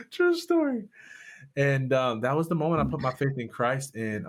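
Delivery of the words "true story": high laughing remark. Real, not acted. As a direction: say it through laughter. Laughing after punchline.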